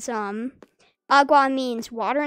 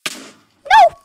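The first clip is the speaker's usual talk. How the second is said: much higher, loud cry of dismay; dismayed shout; 15 dB louder than usual. Real, not acted. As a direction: yell it as you fall. Running off the edge.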